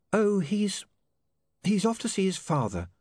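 high-pitched, halting, mildly stunned; taken aback. A narrator's reading. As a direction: stumble into it as if still startled. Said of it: "still taken aback".

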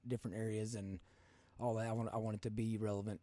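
usual speaking voice

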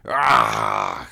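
low frustrated groan; drawn-out growl of exasperation; angry exclamation. A narrator's reading. Narrator frustrated by Sajin's retort, angry groan. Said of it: "I exclaimed angrily".

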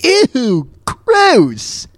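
high, drawn-out disgust; long, high-pitched cry of being grossed out. Acted. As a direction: wail it in exaggerated revulsion. Still disgusted at the joke.

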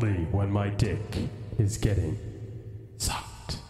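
a crude joke in the solemn fish voice; deep fish voice. Acted. Goldfish Jeremy answers a question crudely, deep, then whispered. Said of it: "such a deep voice".

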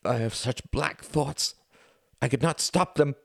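high, cracked, sobbing voice; voice breaking. A narrator's reading. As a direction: let the voice break completely. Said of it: "voice broke completely".

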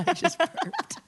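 high, slow, laughing. Real, not acted. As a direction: say it through a giggle. Laughing after burp.

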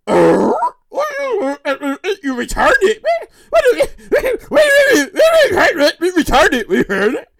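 sputtering mock disbelief, pitch jumping about; baffled family. Family hearing she returned the cash, stunned sputtering. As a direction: stammer in hammed-up shock.

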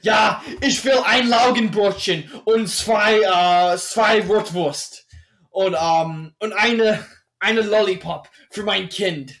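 imitating angry-sounding bakery German, loud and slow; mock-menacing, drawn-out German order; threatening German voice. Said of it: "somewhat threatening voice".

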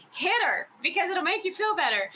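a high, lightly voiced imitation of the movie line; Steel Magnolias quote. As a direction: pitch it up like the film character egging her on. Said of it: "grabs her friend and she's like".

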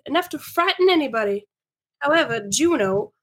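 animated story reading with big pitch swings; lively, theatrical narration; [name]'s storytelling. Acted. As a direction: relish the tale for an audience of friends.

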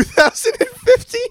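real, high-pitched amazement; incredulous. Real, not acted. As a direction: pitch shoots way up on the question. Friend incredulous at the car year.

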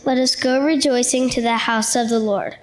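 intoned response. Acted.

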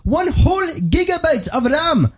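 very high, slow, hammed-up Indian accent; pushy Indian salesman. Salesman pushes the free Samsung, in a mock Indian accent. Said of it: "He goes".

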